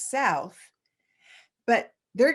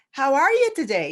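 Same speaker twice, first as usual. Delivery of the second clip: half-done Irish lilt, higher and louder; a cheery Irish greeting in a jokey Irish accent; mock Irish accent; 5 semitones higher, 6 dB louder than usual. Dublin locals greeting mom and dad, jokey Irish accent. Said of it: "I have to do a Lucky Charms accent".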